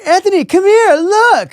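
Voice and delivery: very high, loud mock beckoning; high-pitched urgent summons, acted out; excited calling over. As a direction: imitate a friend excitedly calling someone over. Acted friend calling him over.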